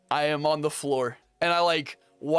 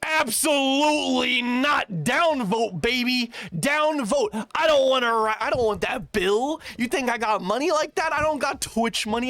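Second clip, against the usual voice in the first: emphatic refusal; 9 semitones higher than usual. Retold or imitated. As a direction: blast it like outraged at the bill. Refusing the ambulance ride.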